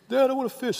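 put-on high childish voice asking for a fish; kid asking dad. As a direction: play the son pleading to his dad.

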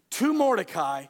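normal talking voice

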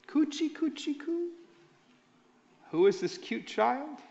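put-on high, soft cooing baby talk. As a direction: coo at a newborn in the crib.

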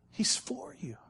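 breathy whispered reassurance. Acted. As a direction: lean in and whisper it.

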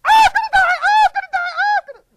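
very high, loud mock panic; panicked shriek. Preacher imitating himself bitten by a viper, shrieking. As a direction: shriek in hammed-up terror. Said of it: "he went".

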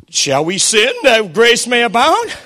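slow, lightly voiced doubter; a hint of a sly questioner asking; mock questioner. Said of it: "they were thinking this".

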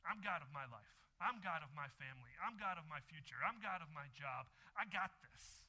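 mock-proud "I'm God" refrain; defiant boast. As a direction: push back at God like you run everything. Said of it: "We say".

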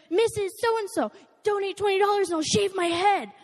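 bold dare. Retold or imitated. Kid barging into classrooms.

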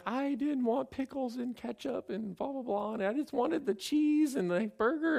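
high, drawn-out whiny wail imitating a crying kid; hammed-up tearful child's complaint; mock bawling. Daughter crying to dad over her cheeseburger, mock bawling. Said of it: "So she's bawling".

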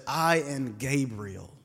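slow, low, weighty angel voice, lightly voiced; solemn angel Gabriel. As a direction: announce it like a heavenly messenger, unhurried. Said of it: "And then the angel said".

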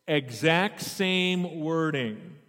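ordinary talk

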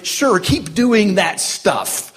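mock-encouraging jab; sarcastic taunt. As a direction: egg them on sarcastically, drawing it out. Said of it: "begins with this taunt from God".

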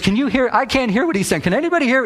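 high-pitched mock protest; put-on high voice, indignant questions; imitated complaining woman. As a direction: play the objector appealing to the room. Retold woman complaining she can't hear.

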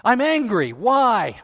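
loud, high angry outburst; acted anger. Preacher playing a hypothetical angry self, loud. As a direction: burst out with indignation, hammed up. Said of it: "This is just hypothetical".

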